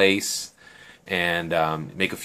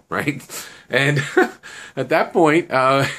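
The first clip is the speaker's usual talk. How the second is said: laughing through the words, pitched up; real amused laughter; 7 semitones higher than usual. Real, not acted. Teller amused by the plan to get the phone, laughing. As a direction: break into chuckles while carrying on.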